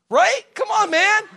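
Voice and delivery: very high, loud appeal; mock protest. Preacher challenging why Peter's boat was picked, mock protest. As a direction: squeal it out in playful outrage.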